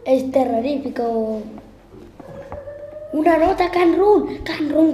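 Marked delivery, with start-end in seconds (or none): 3.1-4.9 high, loud panicked call; being chased. Real, not acted. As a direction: call it out on the run. Spots note while chased.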